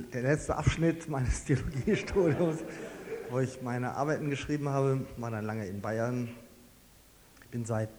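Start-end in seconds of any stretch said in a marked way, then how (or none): none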